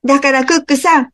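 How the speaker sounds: pleading all at once; animals pleading together. Acted. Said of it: "動物たちは口を揃えて言いました". (高い声で声を揃えてお願いする動物たち)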